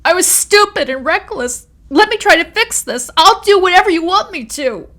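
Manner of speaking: very high, loud frantic plea; desperate begging. A narrator's reading. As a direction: beg her to name any price. Amanda keeps begging.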